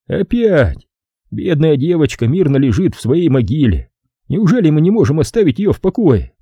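high-pitched plaintive character voice; Rand, weary protest. Acted. (жалобный протест, высоким голосом)

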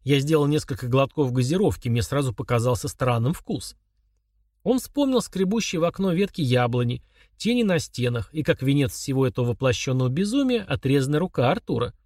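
usual speaking voice

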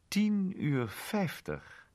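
gretig en hoog, heel langzaam uitgesproken; de inspecteur grijpt gretig het tijdstip. Acted. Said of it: "zei de inspecteur gretig". (inspecteur tegen Monty, gretig en gespannen)

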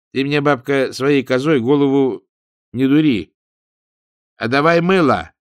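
put-on rough young woman demanding. A narrator's reading. Ninka demands soap from the old woman, pushy. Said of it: "сказала Нинка".

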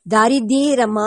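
ದಾರಿದ್ದೀರಮ್ಮ split as daari-ddii-ramma; syllables separated. Acted. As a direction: stretch the long "ii" in ದಾರಿದ್ದೀರಮ್ಮ. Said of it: "ಅದು ನಿಮ್ಮ ಕಿವಿಗೆ ತಪ್ಪು ಕೇಳಿಸಿರಬಹುದು".

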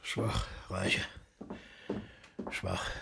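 low, soft disdain; quiet contempt. Acted. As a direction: mutter the verdict to yourself. Bach coldly dismisses Reiche.

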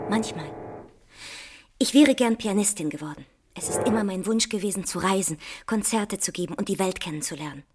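normal talking voice

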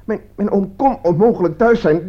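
high, stuttering disbelief; shocked stammer. Acted. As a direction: stumble over the words in shock. Stammering shock after the calls.

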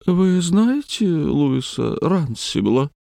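high, slow insinuating voice; a thin eerie wheedle; thin wheedling voice. A narrator's reading.

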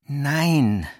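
understated anguished groan; groaning outburst. Acted. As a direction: burst out in despair, then fade. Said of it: "stöhnte Walter nun plötzlich auf".

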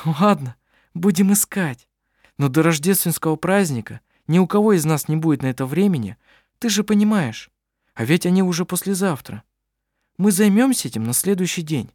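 cheerful practical reassurance; friendly young woman. A narrator's reading. Anna-Maria answers kindly.

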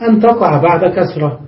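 ordinary talk, nothing marked

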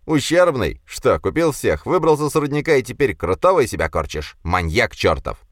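high-pitched, jeering rich brat; squeaky bully. A narrator's reading. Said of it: "В сочетании с писклявым голосом".

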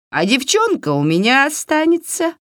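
high, gloating hag voice; high-pitched witchy taunt; taunting witch. A narrator's reading. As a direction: tease it out as a mean old witch. Yaga taunts the girl.

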